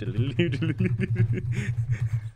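loud, high rolling "liddle-iddle" babble; imitated spazzing noise. Player mimics horse spazzing out, loud high gibberish. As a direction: babble wildly up high, tongue flapping.

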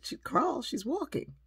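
high, loud proud-parent retort; retold boast, punched out with energy; emphatic correction. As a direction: top his question emphatically. Mother retells boasting to doctor.

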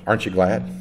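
ordinary talk, nothing marked

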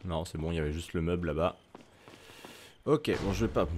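usual speaking voice

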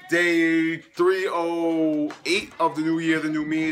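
loud, slow, drawn-out count; hyped announcer. Real, not acted. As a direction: pump up the day number. Hyped intro of his day count.

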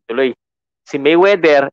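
usual speaking voice

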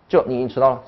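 normal talking voice

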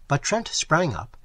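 ordinary delivery

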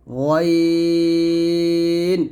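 গয়েন from the top of the throat, held long; throat letter demo. Shown as a demonstration. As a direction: stretch গয়েন on one level note. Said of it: "কণ্ঠনালীর শেষ থেকে". (কণ্ঠনালী থেকে টানা গয়েন)

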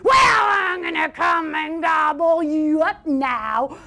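slow, drawn-out roaring troll shout; troll's roar. Acted. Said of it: "roared the troll".

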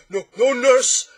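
high, drawn-out roar of glee; uproarious bellow. A narrator's reading. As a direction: bellow your own joke hilariously. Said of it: "doubling up with laughter".

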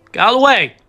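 high, loud order to move; impatient shout. Pretend. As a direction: yell at someone blocking the path. Shouts after polite request fails.

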